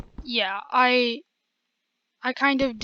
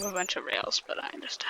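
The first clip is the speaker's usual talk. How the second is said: whisper; 4 semitones lower, 9 dB quieter than usual. Real, not acted. Aside while picking up rails.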